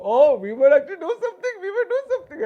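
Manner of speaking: high, laughing mock hope; mocking Madrid fans. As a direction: squeak it out in a giggling, mocking voice. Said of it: "Madrid got some hope".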